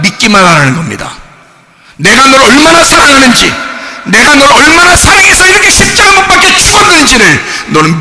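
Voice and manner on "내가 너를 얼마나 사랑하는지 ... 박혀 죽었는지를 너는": high, rising cry in God's voice; impassioned preaching. Acted. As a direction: plead at full force, climbing in pitch. Preaches as God speaking. (하나님의 마음으로 간절히 외치는 설교)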